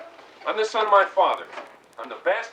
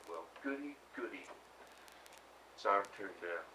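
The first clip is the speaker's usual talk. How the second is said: sarcastic; 8 semitones lower, 18 dB quieter than usual. Acted. Sarcastic reply about war adventure.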